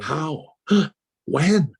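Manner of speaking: re-enacted stunned disbelief with a gasp; grieving shock. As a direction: ask as if somebody really died. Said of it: "you'd think somebody really died".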